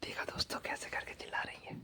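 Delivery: whispered aside to the viewers. Real, not acted. Vlogger whispering to viewers about his sister-in-law shouting. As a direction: whisper so she cannot hear.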